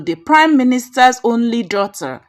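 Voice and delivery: ordinary delivery, nothing marked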